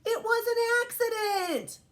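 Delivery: put-on high-pitched little-boy protest; high, insistent kid voice; David's excuse. A narrator's reading. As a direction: cry it out like a child caught out. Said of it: "And he says".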